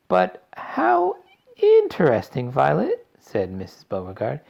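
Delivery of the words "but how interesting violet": gushing mother voice. A narrator's reading. Mother impressed.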